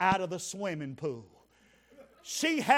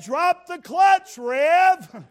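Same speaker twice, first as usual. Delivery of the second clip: loud and higher, slowed and hammed up; hollered command; 8 semitones higher, 11 dB louder, much slower than usual. Big woman yelling to the preacher to drop the clutch, loud mock holler. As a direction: bellow it across the parking lot.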